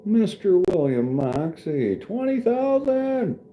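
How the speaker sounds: big high drawn-out announcer voice; booming mock announcer reading the donation; announcer call. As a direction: call it out like a game-show host. Reading out a donor's tip.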